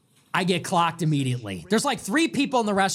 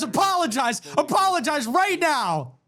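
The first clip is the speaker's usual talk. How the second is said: indignant demand; 7 semitones higher than usual. Retold or imitated. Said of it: "He goes".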